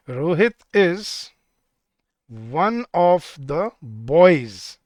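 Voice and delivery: drawn-out words with long gaps; slow dictation. Shown as a demonstration. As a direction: dictate it piece by piece, slowly. Dictates new example while writing.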